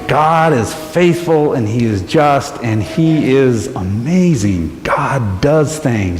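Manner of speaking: slow, sincere declaration; slow earnest testimony. Real, not acted. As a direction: slowly affirm God's goodness. Closing testimony.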